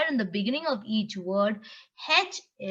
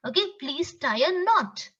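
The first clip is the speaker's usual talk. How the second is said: ordinary talk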